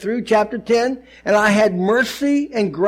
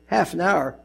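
usual speaking voice

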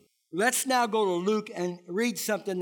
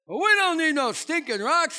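higher, louder mock bandit line; jokey caller voice; 7 semitones higher, 6 dB louder than usual. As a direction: ham it up like a movie bandit. Callers' jokey radio reply.

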